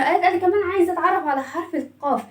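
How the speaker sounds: usual speaking voice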